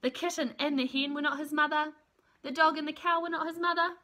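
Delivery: usual speaking voice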